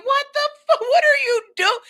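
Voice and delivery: high, loud cry of disbelief, cut off; re-enacted scream. Host re-enacting first reaction to the video, screaming. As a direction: replay your own shocked yell. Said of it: "I screamed the same thing".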